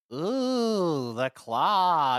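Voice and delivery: squeaky little alien voice; awed alien squeal; Toy Story aliens. Retold or imitated. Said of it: "the aliens going".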